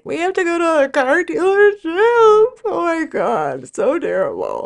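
high, drawn-out mock whine from the son; whiny kid.

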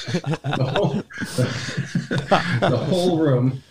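laughing through the words; giggling as he tells it. Real, not acted. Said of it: "laughing while telling".

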